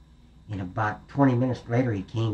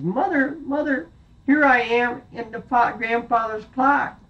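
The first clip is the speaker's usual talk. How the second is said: high, slow call from the hiding place; little goat calling; 11 semitones higher than usual. Acted. The last goat calls to his mother from the clock, high. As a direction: cry out to be found.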